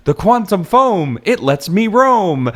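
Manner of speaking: high, slow singsong rhyme; crazy rhymer. Retold or imitated. Crazy man babbling rhymes, high slow singsong. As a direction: chant the rhyme high and drawn out. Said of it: "he's saying things like".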